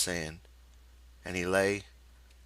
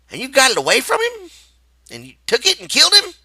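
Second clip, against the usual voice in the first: high, loud, fast disbelieving questions; Carlson's voice; 14 dB louder, faster than usual. A narrator's reading. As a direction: fire the questions at him.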